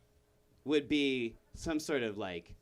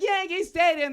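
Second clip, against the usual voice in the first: shouted suggestion; 13 semitones higher, 8 dB louder than usual. Acted. Boston guy shouts suggestion.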